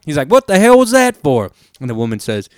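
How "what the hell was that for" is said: high, loud indignant southern drawl; old joke-teller's southern twang, voice pitched way up. Retold or imitated. Husband just smacked by his wife, indignant southern drawl. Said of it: "He had, like, a kinda southerny little drawl going".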